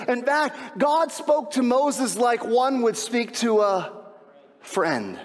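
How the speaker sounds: high-pitched, stretched emphatic delivery; impassioned preaching. Real, not acted. Preacher proclaiming God's partnership with his people, impassioned preaching. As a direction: build the point with drawn-out key words.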